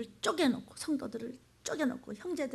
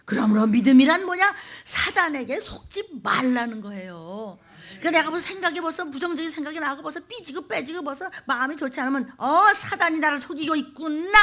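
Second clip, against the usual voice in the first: loud, raised, ringing sermon voice; full-force preaching; 5 semitones higher, 8 dB louder than usual. Real, not acted. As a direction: cry out the point to the congregation. (목청 높인 힘찬 설교)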